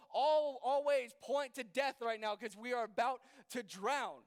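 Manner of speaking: acted alarm, hammering out the danger; panicked protest. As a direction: argue urgently as if about to drown. Imagined frightened disciples protesting to Jesus.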